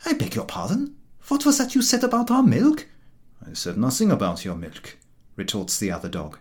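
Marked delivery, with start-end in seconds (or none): 0.0-2.9 higher, indignant challenge; bristling at an insult to his milk; offended dog. A narrator's reading. Milk-cart dog confronting the jeering dog, indignant and higher. 3.5-5.0 lower, mildly innocent denial; gentle innocence. A narrator's reading. Jeering dog to the milk-cart dog, mock gentle innocence. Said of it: "in a tone of gentle innocence".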